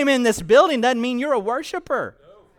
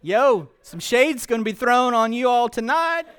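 hip slang Jesus; 5 semitones higher than usual. Acted. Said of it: "So Jesus is like".

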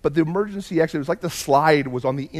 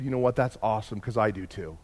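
softly conspiratorial; 6 semitones lower, 8 dB quieter than usual. Retold or imitated. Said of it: "I turned to him and I said".

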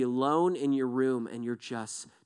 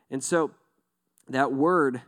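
ordinary talk

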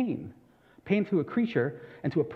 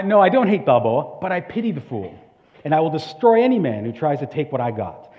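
loud, raised-pitch boxer's boast; Mister T; 9 dB louder than usual. Acted. Boxer answering an interviewer about his rival, loud and defiant. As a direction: declare it forcefully, as a quoted challenge. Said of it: "his response is, and I quote".

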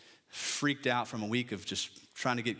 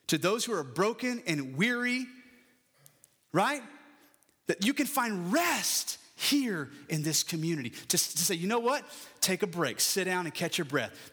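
full-force preaching; 7 semitones higher than usual. Acted. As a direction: preach it out to the congregation. Preaching invitation to the weary.

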